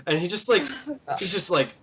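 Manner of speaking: normal talking voice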